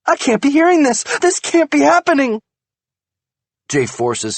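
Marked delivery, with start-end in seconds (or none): 0.0-2.4 high, loud frantic outburst; frantic rage. A narrator's reading. Wife paces and rants at husband, frantic. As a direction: rant in disbelief.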